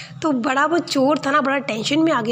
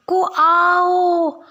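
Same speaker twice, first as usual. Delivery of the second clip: calling out; 2 semitones higher, 3 dB louder, much slower than usual. Acted. Calls out again.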